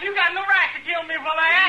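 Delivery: high, desperate begging; frightened plea. Acted. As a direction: plead for your life, scared. Son begs father for life.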